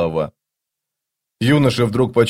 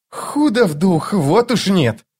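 mocking sneer; 9 semitones higher, slower than usual. A narrator's reading. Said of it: "с издёвкой процедил Крокус".

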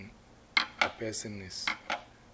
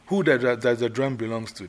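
stern questioning; 2 semitones higher, 8 dB louder than usual. Shown as a demonstration. Queen Mother rehearsing, loudly interrogating the player. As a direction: press him for the truth.

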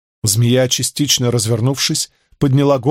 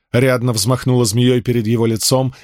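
ordinary delivery, nothing marked

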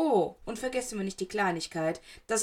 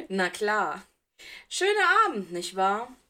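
bitter mocking Potter; 5 dB louder, slower than usual. A narrator's reading. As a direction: sneer at the teacher, desperate. Potter sarcastic. (höhere, bitter spottende Stimme)

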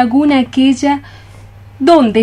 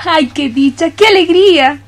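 joyful cry; 4 semitones higher than usual. Acted. Girl hears she'll wed her love.

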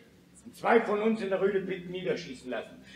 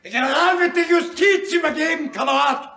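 much higher, louder shouted reply; heated shout; 12 semitones higher, 10 dB louder than usual. Acted. Son shouting back at the father in the quarrel. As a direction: shout him down.

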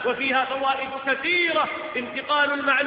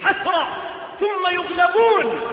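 slow, loud declaration; emphatic shout; 5 semitones higher, 5 dB louder than usual. Acted. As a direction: hammer each word slowly. Preacher's emphatic slow climax. (صيحة مؤكدة بطيئة)

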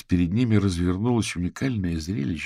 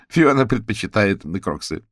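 louder, mildly condescending jibe; a touch of amused superiority; 3 semitones higher, 6 dB louder than usual. A narrator's reading. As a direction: look down on a friend's taste. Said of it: "снисходительно хохотнула Софи".

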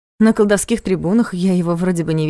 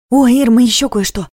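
excited exclamation; 5 semitones higher, 6 dB louder than usual. A narrator's reading. Lita's sudden exclamation.

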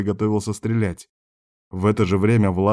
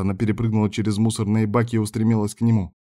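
ordinary delivery, nothing marked

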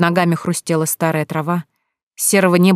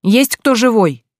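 slow, loud, high-pitched hail; calling out; 5 semitones higher, 5 dB louder, slower than usual. A narrator's reading. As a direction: shout slowly to see if anyone answers. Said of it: "закричал Егор". (протяжно кричит, зовя людей)

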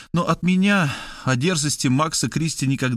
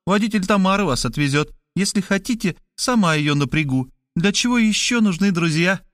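higher, bluntly cheerful woman; higher woman's voice, tactless and chirpy; brash Markhaleva; 6 semitones higher than usual. A narrator's reading. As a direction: drop the bad news breezily.

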